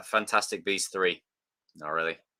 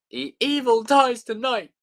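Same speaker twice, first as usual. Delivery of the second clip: high, slow chanting mob voice; dramatic movie quote; 12 semitones higher, 4 dB louder than usual. Pretend. Quoting the film to friends, high and slow. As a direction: cry it out like a crowd. Quoting Halloween Kills line.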